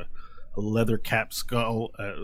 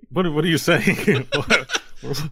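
higher-pitched, laughing disbelief; laughing question; 9 semitones higher, 7 dB louder than usual. Real, not acted. Game master catches player teasing about names, laughing incredulously. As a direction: ask it through laughter, incredulous.

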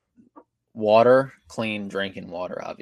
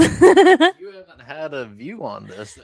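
laughing; 6 semitones higher, 11 dB louder, slower than usual. Real, not acted. Teasing friend, laughing.

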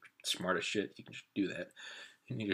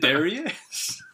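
high, loud drawn-out cheer; hailing; 8 semitones higher, 12 dB louder, much slower than usual. Pretend. Listener playfully hails the man on the porch, loud drawn-out call.

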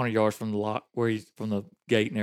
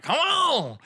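high, drawn-out mock holler; exasperated high-pitched hurry-up; impatient urging. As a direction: imitate a man calling boaters on. Lock keeper waving them on, imitated.

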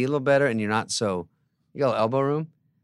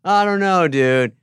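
louder and higher, lazy shrug; dumb stoner; 7 semitones higher, 7 dB louder, slower than usual. Retold or imitated. A squatter shrugs off moving, loud dumb bro voice. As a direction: play a clueless drunk dude.

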